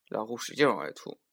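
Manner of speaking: ordinary delivery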